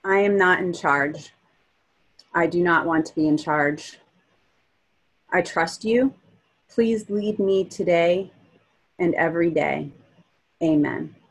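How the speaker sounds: earnest read prayer; praying. Real, not acted. Reads her written prayer aloud.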